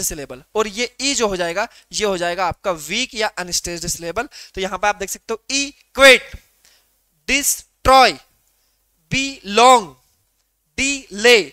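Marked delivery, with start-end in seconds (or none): 5.6-11.4 louder second beat in "equate, destroy, belong, delay"; "क्वेट", "Troy", "लोंग", "ले" punched after a gap, pausing between words; stress on second syllable. Shown as a demonstration. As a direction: split "equate, destroy, belong, delay" in two, weak then strong. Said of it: "पहला वाला अगर आपका unstressed".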